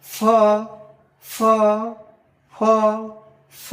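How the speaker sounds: ফ as a blown F; teeth-on-lip F. Shown as a demonstration. Teacher contrasting the two sounds, ফ as airy F. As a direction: push air through teeth and lip on ফ. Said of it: "এটা".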